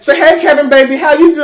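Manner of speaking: mock-affectionate high greeting; cheerful cashier. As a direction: gush over Kevin. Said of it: "she's like".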